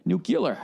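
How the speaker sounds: Nuclear with the yuh roll, nook-uh-ler; Nuclear with rolled middle; nuke-you-ler. Shown as a demonstration. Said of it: "a little shoulder roll in there".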